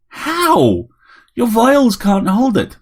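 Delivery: a grumpy widower caught off guard; startled old man. A narrator's reading. Harry questioning the robot, incredulous.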